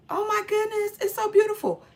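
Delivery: high, swooning mock delight; gushing delight. Retold wife receiving a proposal, high mock gushing. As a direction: imitate a woman thrilled by a proposal.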